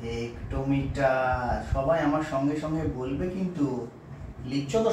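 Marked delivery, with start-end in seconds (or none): 0.0-1.6 2 drawn out very slowly for pupils to repeat; 3 say kilometre syllable by syllable, very slowly; 1 slow dictation. Shown as a demonstration. 4 teacher reading kilometre aloud for pupils to copy, very slow. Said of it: "উচ্চারণ করে শোনাবো".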